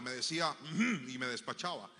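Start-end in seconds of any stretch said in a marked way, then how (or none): none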